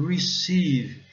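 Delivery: "receive" with a long, stretched ee; prolonged vowel. Shown as a demonstration. Teacher shows the long vowel, careful English pronunciation. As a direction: hold the I sound in "receive", loud and slow. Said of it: "a letra prolongada".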